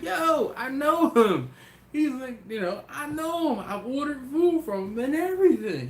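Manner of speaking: high, loud insistent buddy; insistent friend. Retold or imitated. Friend insists to doubting buddy he knows him, high and loud. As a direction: insist hard, pitch way up.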